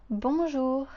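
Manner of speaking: teacher's model bonjour; French bonjour. Shown as a demonstration. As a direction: clear model bonjour for repeating. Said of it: "try to repeat after me".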